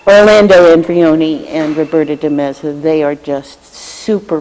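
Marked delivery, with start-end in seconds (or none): 0.1-1.5 "Orlando Andrioni" said louder, each part stressed; emphatic full name. Real, not acted. Thanking committee staff, he nails the name loudly. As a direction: land the full name firmly after stumbling.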